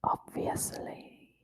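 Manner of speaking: slow breathy whisper. Real, not acted. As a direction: whisper it slowly. Whispered echo.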